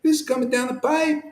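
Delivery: high whooping mock cheer; mock excitement. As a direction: lampoon the gleeful crowd with a high "Woo". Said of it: "people are just like".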